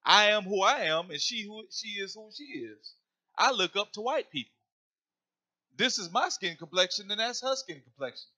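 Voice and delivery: lightly voiced haughty daughter; voicing the daughter. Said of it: "still in the thought of".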